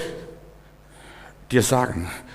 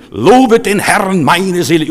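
loud, slow, higher proclamation; mirror self-preaching; 5 semitones higher, 5 dB louder, slower than usual. Acted. Preacher commanding his soul before the mirror, loud and slow. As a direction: proclaim it to your own soul, drawn out. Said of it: "Du stellst dich vorm Spiegel".

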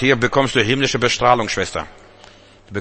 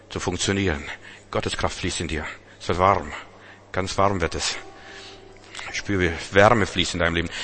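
soft healing prayer; 3 semitones lower, 5 dB quieter than usual. Acted.

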